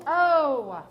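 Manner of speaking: a dim giant, loudly; booming giant oh. Acted. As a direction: giant accepting the excuse, loud. Giant's reply.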